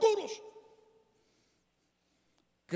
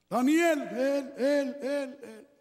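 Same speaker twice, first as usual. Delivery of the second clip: echo; 2 semitones higher, much slower than usual. Shown as a demonstration. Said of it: "el eco de la misma voz del rey".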